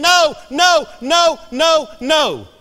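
loud, high-pitched repeated cries of refusal; emphatic inner protest. Acted. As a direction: hammer out each NO with full force. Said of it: "His feelings were saying".

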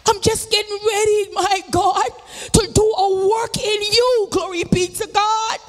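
full-force preaching. Acted. Preacher testifying to the congregation, full-force preaching. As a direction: preach it out to the saints.

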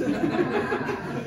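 short breathy burst of laughter. Real, not acted. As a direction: laugh out at the absurd idea. Laughs at London house prices.

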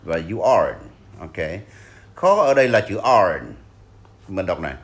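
ordinary delivery

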